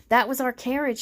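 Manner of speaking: plain reading voice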